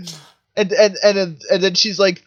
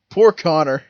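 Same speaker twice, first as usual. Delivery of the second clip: ordinary delivery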